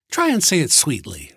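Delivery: high, mocking woman's voice; sarcastic. A narrator's reading. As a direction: dare him with a sneer. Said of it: "she said sarcastically".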